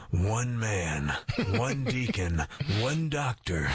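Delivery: lightly voiced dramatic trailer narrator; a hint of an epic trailer read, with a laugh; movie trailer voice. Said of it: "Doesn't that sound like an intense movie trailer?".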